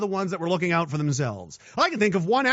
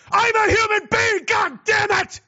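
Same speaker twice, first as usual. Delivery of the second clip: high yelled movie quote; quoting a shout; 12 semitones higher than usual. Retold or imitated. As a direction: bellow it like the angry anchorman. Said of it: "He says".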